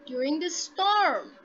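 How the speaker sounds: slow "storm", lifted then dropping; rising question. Shown as a demonstration. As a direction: stretch and lift "storm". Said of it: "rising intonation to ask".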